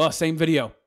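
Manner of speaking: ordinary delivery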